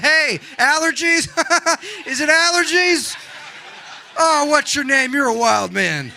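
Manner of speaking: loud, high, delighted hollering; calling across a restaurant. Acted. Diner greeting a sneezer across a restaurant, loud and chummy. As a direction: holler chummily to someone tables away. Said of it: "talk to you across a restaurant".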